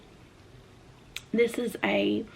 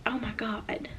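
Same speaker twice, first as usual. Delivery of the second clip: shocked whisper; 7 dB quieter than usual. Retold or imitated. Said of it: "I was just kinda like".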